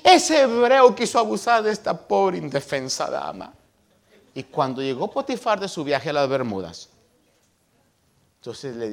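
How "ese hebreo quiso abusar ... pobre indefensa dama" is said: drama queen. Retold or imitated. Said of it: "como era drama queen".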